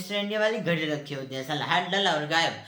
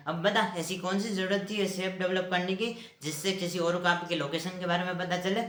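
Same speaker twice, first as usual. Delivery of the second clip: ordinary talk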